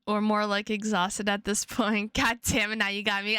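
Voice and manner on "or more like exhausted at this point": usual speaking voice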